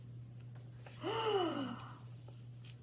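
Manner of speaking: gasp. Acted. Scary cat reveal.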